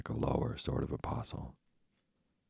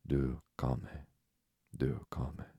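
put-on French accent; much slower than usual. A narrator's reading. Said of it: "He lifted a warning forefinger".

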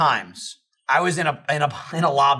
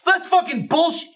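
mock outraged victim. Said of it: "you hear, like".

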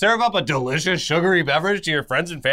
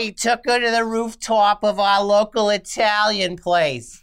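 put-on Staten Island accent; hammed-up Staten Island voice; Staten Island mother; 3 semitones higher than usual. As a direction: boast like a Staten Island mom. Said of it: "the woman from Staten Island".

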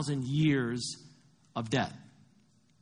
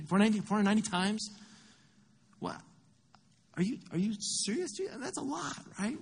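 higher-pitched, incredulous questioning; stunned disbelief; 7 semitones higher than usual. Acted. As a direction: balk at it like a baffled disciple. Acted Peter stunned by the number.

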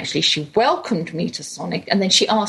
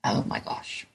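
whispered, much softer aside; hushed dismay; 11 dB quieter than usual. Retold or imitated. Mother finds herself at the drive-in, whispered dismay. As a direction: drop to a whisper, as if dreading it.

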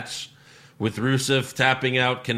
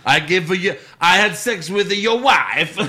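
hammed-up Borat Bulgarian accent; 6 semitones higher, 6 dB louder than usual. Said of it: "he's Borat".

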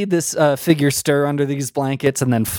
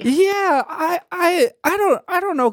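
pitched way up, wistful little fairy; high fairy voice; 11 semitones higher than usual. Acted. GM redoing the fairy's line in the right high voice. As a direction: redo it high and sighing.

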